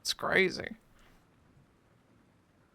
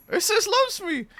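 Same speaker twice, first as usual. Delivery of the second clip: squeaky hopeful voice. Pretend. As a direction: squeal it like a smitten girl. Petal game alternating voices.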